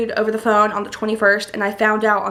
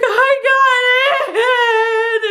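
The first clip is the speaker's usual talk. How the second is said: high, loud wail, drawn right out; happy crying; 5 dB louder, much slower than usual. Retold or imitated. Daughter telling mom she got in, high tearful wail. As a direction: re-enact a tearful squeal of joy. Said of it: "I immediately start crying".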